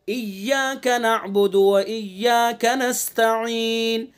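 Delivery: slow melodic tajweed chant; Quran recitation. Shown as a demonstration. Teacher reciting the verse slowly for students. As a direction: draw each syllable out in recitation.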